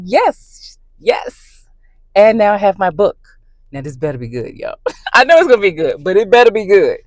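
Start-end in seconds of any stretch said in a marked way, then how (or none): 0.0-1.7 high eager yes, then whispered. Retold or imitated. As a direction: squeal then breathe it. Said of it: "I was like".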